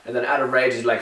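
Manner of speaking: ordinary talk, nothing marked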